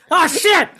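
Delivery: high startled outburst; surprised "oh shit". Retold or imitated. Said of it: "I'm like".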